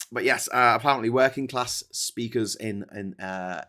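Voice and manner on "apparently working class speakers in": ordinary delivery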